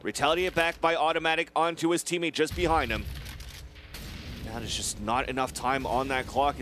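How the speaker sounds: ordinary talk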